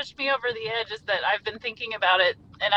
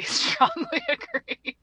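much higher, slow, giggling strongly agree; cracking up at her own answers; laughing repeat; much slower than usual. Retold or imitated. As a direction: laugh it out up high.

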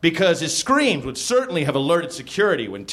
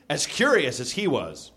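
ordinary delivery

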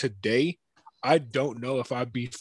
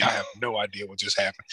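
ordinary talk, nothing marked

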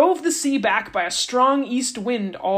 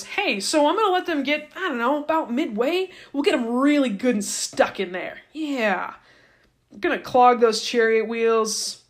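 scheming God; 3 semitones higher than usual. Retold or imitated. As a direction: plot the trap with playful glee. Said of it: "The Lord says to Moses".

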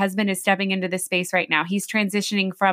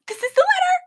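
much higher, panicked squeak; shocked realization; faster than usual. Retold or imitated. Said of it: "And I'm like".

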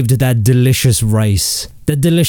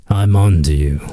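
mock menacing; 8 semitones lower than usual. Comedian repeating his warning to Pepsi, mock sinister and much lower. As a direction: growl "I'm on to you" like a movie villain's threat.

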